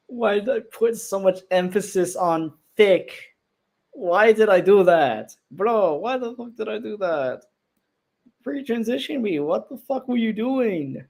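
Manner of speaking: high, incredulous self-scolding; exasperated disbelief. Real, not acted. Creator reacting to own old voice acting, exasperated and incredulous.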